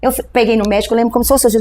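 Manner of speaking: ordinary delivery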